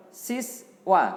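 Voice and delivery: loud, high model word to repeat; sounding out words. Shown as a demonstration. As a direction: call the word out slowly to pupils. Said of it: "Ikuti [name]". (mengeja kata dengan lantang)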